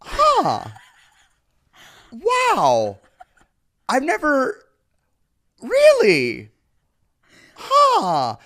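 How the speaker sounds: high, loud, drawn-out wonder; mock amazement. People hearing he's from Nebraska, mock amazed. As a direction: act hugely amazed, pausing between reactions.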